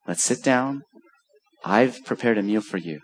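low, soft and gentle; tender invitation. Acted. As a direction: invite them in kindly.

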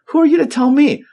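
a sudden high, loud indignant burst that falls away; miffed outburst. Retold or imitated. Miffed fisherman snapping at a stranger on the shore, high then dropping. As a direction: bristle at being told how to fish. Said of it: "I'd be a little miffed".

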